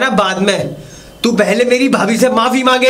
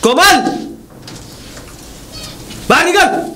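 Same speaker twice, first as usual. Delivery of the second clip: yelling; 7 semitones higher than usual. Acted. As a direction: bellow her name across the house. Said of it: "इतना क्यों चिल्ला रहे हो?".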